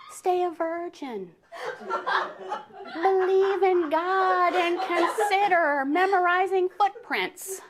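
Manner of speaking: put-on very high, slow prim girl voice; sweet doll voice. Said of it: "I can just hear her voice".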